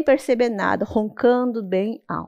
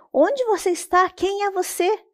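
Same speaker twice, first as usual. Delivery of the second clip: higher, startled asking; scared question; 9 semitones higher than usual. Acted. Boy asking the unseen voice where it is, startled. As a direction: ask in a startled rush. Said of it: "assustado perguntou".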